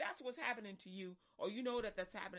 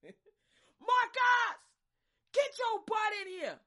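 much higher, much louder furious mother's shout; angry mom calling her son in; mama yelling; 12 semitones higher, 14 dB louder, much slower than usual. Retold or imitated. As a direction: holler the kid home from down the street. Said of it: "she see you down the street".

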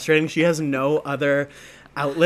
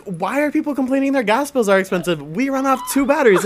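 mock indignation; 9 semitones higher, 5 dB louder than usual. Acted naive outrage about heating bills.

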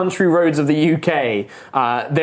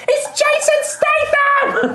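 very high, slow excited shriek; starstruck scream; slower than usual. Acted. Teens recognising Jason Statham, shrieking high. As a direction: squeal at the celebrity. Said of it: "they're like".

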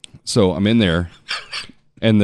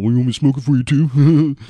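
mock-snide retort, a bit higher and louder; sarcastic comeback; 5 semitones higher, 4 dB louder than usual. Retelling his snarky reply to a cigarette moocher. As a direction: toss it back with a sneer. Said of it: "I wanna give him the old".

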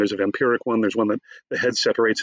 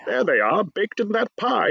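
much higher, hammed-up Shakespearean actor; Patrick Stewart; 10 semitones higher, slower than usual. Great actor failing to make an absurd line work, hammed-up declaiming. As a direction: declaim it grandly, stretching out the final words. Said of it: "not even Patrick Stewart could make".

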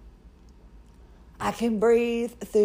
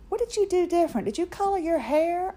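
lightly voiced curious friends, a bit higher; curious friend quote; 8 semitones higher, 3 dB quieter than usual. Friends noticing her new nose, lightly voiced curious asking. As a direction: ask it like a puzzled friend who can't place the change.

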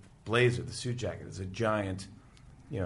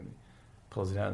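usual speaking voice

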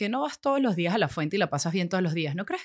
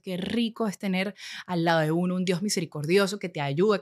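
ordinary talk, nothing marked

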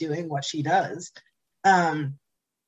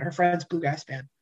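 normal talking voice

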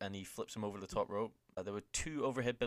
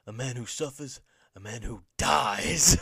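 Bray Wyatt promo; 4 semitones higher, 11 dB louder than usual. Retold or imitated. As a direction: draw it out, building to a booming final word. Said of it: "He sits down in the rocking chair and he says".

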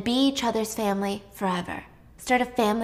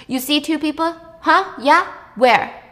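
rising, louder scoffing questions; mocking challenge; 6 semitones higher, 6 dB louder than usual. Dismissive doctor mocking Anna's ghosts, louder and higher. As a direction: taunt her to point them out.

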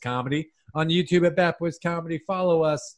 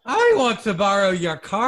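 put-on loud English accent; 5 semitones higher, 5 dB louder than usual. Accent round, borrow your car.

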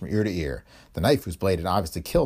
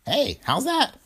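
put-on high, cracked old-man voice feigning deafness; old man playing deaf; much slower than usual. A narrator's reading. Old Willie to Spooner, playing deaf, high and cracked. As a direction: pitch it way up like a hard-of-hearing codger. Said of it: "cupped a gnarled hand about his hearing device".

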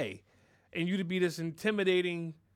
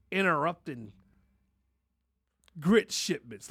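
ordinary delivery

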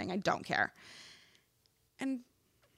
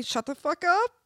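high-pitched defiant retort; retold retort; 9 semitones higher than usual. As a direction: fire it back at him, pitched way up. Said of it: "and I say".

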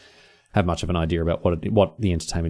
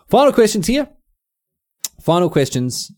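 upbeat announcement; 10 semitones higher, 5 dB louder than usual. Real, not acted. Announcing the next segment.

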